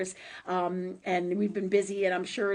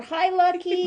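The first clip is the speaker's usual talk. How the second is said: pet talk; 9 semitones higher, 7 dB louder, slower than usual. Real, not acted. Greeting her dog Lucky mid-reading, in sing-song pet talk.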